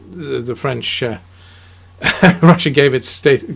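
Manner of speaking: usual speaking voice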